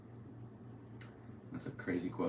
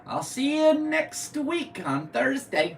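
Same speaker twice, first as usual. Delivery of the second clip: much higher, louder, slow falsetto goodbye; mock sing-song sign-off; 9 dB louder, much slower than usual. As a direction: croon the sign-off in a high voice.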